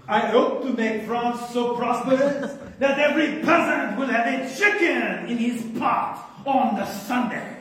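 put-on playful French-accented royal quote; mock French accent. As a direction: ham it up as a French king. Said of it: "So, no French accent".

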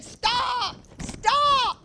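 high, urgent call to stop; a man on the sidewalk calls out to a driver; shouting stop. Retold or imitated. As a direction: wave them down with your voice. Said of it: "jumping and waving his hand".

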